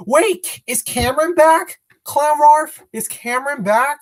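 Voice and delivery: sudden high, eager questioning; excited surprise. Real, not acted. Streamer asks chatter if Cameron is back, excited surprise. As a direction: jump up in pitch, urgent to find out. Hears news that Cameron returned.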